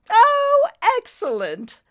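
delighted British exclamation, high and loud; grand British delight, swooping down; Lady Meander. A narrator's reading. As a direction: exclaim like a thrilled British dame. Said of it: "in a most British stately accent".

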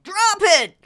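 high, loud order to a dog; yelled command. A narrator's reading. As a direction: yell the command with force. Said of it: "Junior yelled".

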